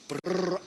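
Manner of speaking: loud brrr from the cold; a loud, chattering brrr on entering the freezer; shivering. Real, not acted. Stepping into the minus-twenty room.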